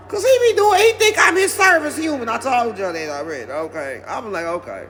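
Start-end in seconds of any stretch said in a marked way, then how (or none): 4.5-4.9 lower, softer self-soothing; hushed breathe-through-it calm. Retold or imitated. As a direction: talk yourself down quietly. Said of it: "I had to do a few Kegels".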